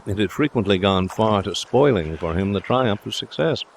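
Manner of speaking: usual speaking voice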